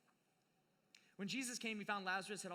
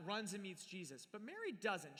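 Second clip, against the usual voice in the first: normal talking voice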